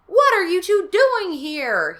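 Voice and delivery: high, loud, slow demand; angry cry. Acted. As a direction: shout at the kids as the furious father. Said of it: "he cried".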